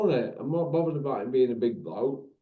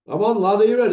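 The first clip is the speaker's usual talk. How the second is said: loud, raised-pitch shout to a mate; calling out; 6 semitones higher, 8 dB louder, much faster than usual. Real, not acted. Host hollers to a mate that he's on, calling out. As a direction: holler it over, high and loud.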